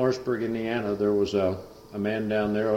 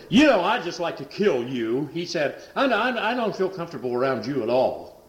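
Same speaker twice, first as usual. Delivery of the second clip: much higher, louder eerie threat; mock menacing threat; 7 semitones higher, 4 dB louder than usual. Possessed son threatening the preacher, much higher voice. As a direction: say it like a chilling threat.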